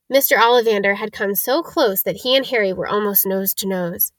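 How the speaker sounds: ordinary delivery, nothing marked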